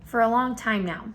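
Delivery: ordinary delivery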